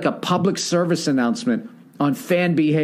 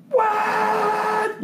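high, long honking WHAT; train horn imitation. Comic imitating the stadium train horn. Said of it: "It just goes".

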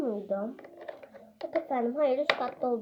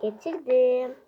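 ordinary talk

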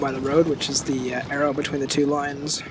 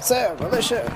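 panicked soldier; 10 semitones higher than usual. Acted. Soldier shouting reports in battle.

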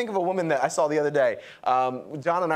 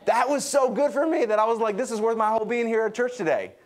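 excited; 7 semitones higher than usual. Real, not acted. Excited about word study.

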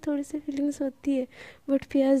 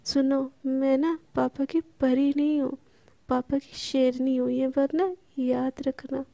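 usual speaking voice

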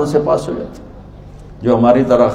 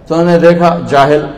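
ordinary talk

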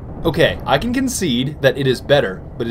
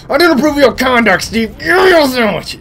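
high, loud, silly yelling; mock scolding shout; 9 semitones higher, 5 dB louder than usual. Gamer scolding Steve for his conduct, high mock shout. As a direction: scold at a high pitch, building to a yell.

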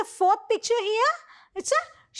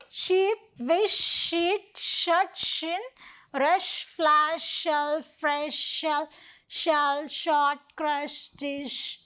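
word list read aloud; 2 semitones lower, much slower than usual. Shown as a demonstration. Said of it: "let us see how the sheep reaches".

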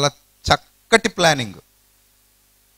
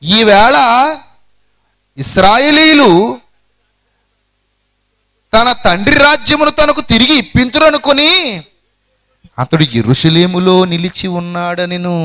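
higher, louder, slow sly telling; scheming servant's lie; 5 semitones higher, 3 dB louder than usual. Acted. Ziba slanders his master to the king, higher and louder. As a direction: play the schemer, drawing out each word. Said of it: "ఏమంటున్నాడు తెలుసా ఈ కుయుక్తిపరుడు".

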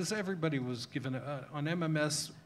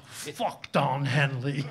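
put-on slow, louder Liverpool accent doing [name]; [name]'s Liverpool accent; 6 dB louder, much slower than usual. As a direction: lean into a Liverpool accent, drawn out and defiant. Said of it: "in his best accent".

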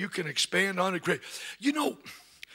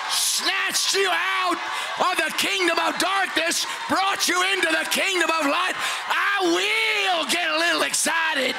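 high shouting sermon cadence, slowed on the climax; preaching at full force; 6 semitones higher than usual. Acted. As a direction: preach it to the back row.

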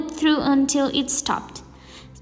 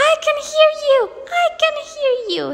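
put-on boy yelling; 13 semitones higher, much faster than usual. A narrator's reading. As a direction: shout it like a thrilled little boy. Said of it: "he yelled".

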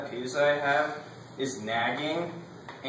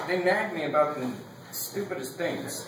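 normal talking voice

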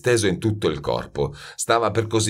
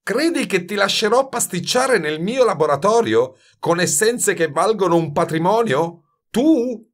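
high, indignant questions rising to a sharp peak; scornful disbelief; 12 semitones higher, 4 dB louder than usual. A narrator's reading. Master refuses youth his laboratory, incredulous scorn. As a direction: build to an outraged last word.

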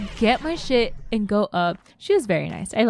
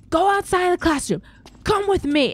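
retold teacher yelling; 6 semitones higher than usual. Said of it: "was yelling at him in the hallway".

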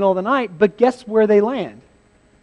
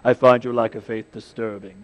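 deep, menacing villain voice; low and measured cold threat; lower-pitched Sith lord imitation; Darth Vader; 8 semitones lower than usual. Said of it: "He says it like Vader says it".

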